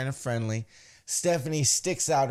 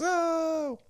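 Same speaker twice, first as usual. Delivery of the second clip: crowd "oh". Retold or imitated. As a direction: imitate a crowd's drawn-out reaction. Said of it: "The crowd goes".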